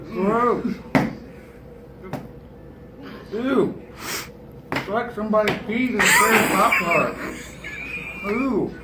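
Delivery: disgusted. Real, not acted. Reacting to gross candy taste.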